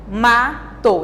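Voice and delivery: MATO split as ma, to with a gap; syllable by syllable. Shown as a demonstration. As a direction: say MATO in two separate beats for a class. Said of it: "palavras com duas sílabas".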